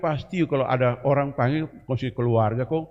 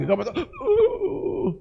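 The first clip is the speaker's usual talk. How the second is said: mock whiny voice; slower than usual. Mocking relapsing sinner.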